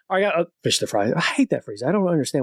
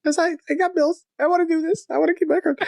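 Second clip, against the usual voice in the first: much higher, laughing mock whine; mock plea; 13 semitones higher than usual. Real, not acted. Joking to his guest about paying bills, high laughing whine. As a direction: whine it playfully through giggles.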